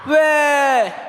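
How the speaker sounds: loud answering cheer; shouted response. Real, not acted. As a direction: yell it back. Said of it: "scream at the top of your voice".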